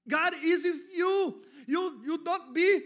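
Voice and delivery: preaching climax at full shout; shouting preacher. Acted. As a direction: rouse the congregation out of fear.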